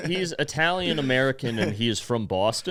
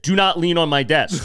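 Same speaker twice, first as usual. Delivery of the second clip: higher, emphatic mock order; retold stern reprimand; imitating angry boss; 4 semitones higher, 4 dB louder than usual. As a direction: spell it out like an irate boss. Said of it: "just yell at people".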